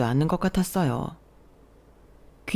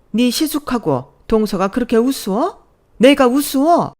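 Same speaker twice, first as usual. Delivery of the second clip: loud, heated mother-in-law tirade; mother-in-law yelling at the scheming daughter-in-law; angry scolding; 5 semitones higher, 5 dB louder than usual. Acted. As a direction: build to a yelling peak at the end. Mother-in-law scolding, pulling hair.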